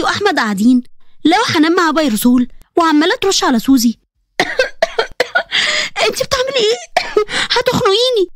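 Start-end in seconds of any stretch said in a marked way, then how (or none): 4.4-6.0 choking cough. Acted. Suzy choking on sprayed insecticide. 6.1-8.3 choking protest. Acted. Suzy choking from spray.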